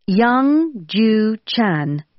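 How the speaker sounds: put-on each syllable of "Young Ju Chan" said very slowly and apart; slow it right down so they catch "Young Ju Chan"; slow, careful repeat. Shown as a demonstration. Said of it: "Sorry, I didn't catch that".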